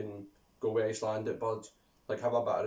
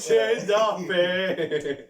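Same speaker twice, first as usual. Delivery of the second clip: high, loud, very slow holler; drawn-out curse; 7 semitones higher, 7 dB louder, much slower than usual. Real, not acted. Cursing hated player for laughs, loud drawn-out shout. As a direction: stretch the name out loud and slow.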